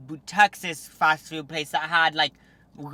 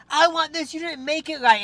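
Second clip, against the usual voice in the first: much higher and louder mock demand; entitled customer; 10 semitones higher, 7 dB louder than usual. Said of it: "people being like".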